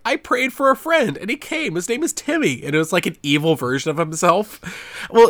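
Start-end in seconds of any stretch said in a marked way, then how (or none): 0.0-2.6 put-on higher, louder sweet little boy voice; mock creepy kid. Quoting Mystery Science Theater, high childish voice. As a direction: sound like an innocent kid with a creepy twist. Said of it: "where he's like".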